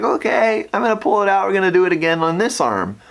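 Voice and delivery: high-pitched nurse voice; imitated nurse. Nurse telling patient about another try, high-pitched.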